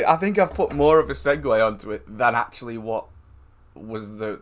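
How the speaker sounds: ordinary delivery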